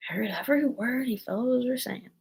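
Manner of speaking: a weakly croaked protest; hoarse, feeble. A narrator's reading. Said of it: "in a hoarse, feeble voice".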